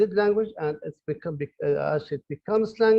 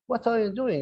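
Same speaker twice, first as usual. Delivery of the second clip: higher, sharp reproach on "What are you doing?"; accusing; 7 semitones higher than usual. Shown as a demonstration. Teacher catching a student doing wrong, scolding. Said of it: "It means that you are rubbish".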